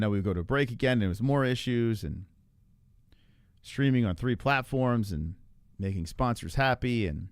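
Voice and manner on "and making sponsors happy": ordinary talk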